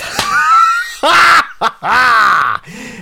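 high, shouted burst of laughter; loud cackling cry. Acted. Quint answers the banging, loud cackling cry. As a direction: let out a piercing, drawn-out laugh.

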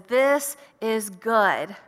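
slow, pleased voice of God; approving God voice. Pretend. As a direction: savor each word as the Creator admiring. God's voice quoted, approving.

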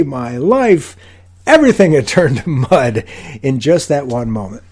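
loud, slow indignation, hammed-up for the story; mock outrage. Acting out his outrage at the stool.

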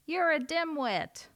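high, slow scornful jab; sneering seagull. A narrator's reading. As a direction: sneer down at the owl. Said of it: "sneered the seagull".

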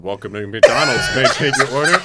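drive-thru worker. Retold or imitated. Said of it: "I heard".

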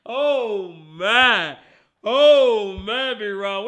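very loud, drawn-out oh man; anguished groan. Real, not acted. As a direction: cry out in mock pain at the loss. Groans over the loss.

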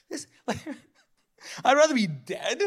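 normal talking voice

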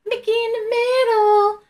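lilting high call of Mickey in the middle; sing-song echo. Pretend. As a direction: chant it like a playground game. Playful echo of the phrase.

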